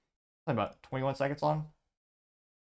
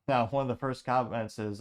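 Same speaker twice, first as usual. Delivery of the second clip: usual speaking voice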